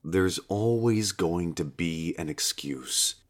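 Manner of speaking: god gently nudges a reluctant Satan. Acted. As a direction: ease it to him kindly. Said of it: "he said gently".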